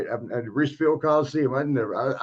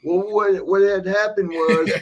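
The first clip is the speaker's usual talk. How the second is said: higher, slowed, mock-innocent excuse voice; lying excuse; 6 semitones higher, 4 dB louder, slower than usual. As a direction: play the guilty defendant stalling. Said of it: "Here comes a lie".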